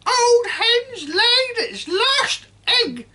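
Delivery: put-on high, loud and gleeful old woman; grandma gloating from the rooftop; cackling grandma shout. A narrator's reading. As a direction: crow it with a cackle. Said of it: "Grandma shouted".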